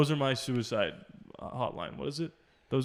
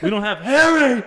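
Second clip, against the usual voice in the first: louder and higher, laughing; excited laughing outburst; 9 semitones higher, 10 dB louder than usual. Real, not acted. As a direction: blurt it out through laughter. Realizing a quote was left out.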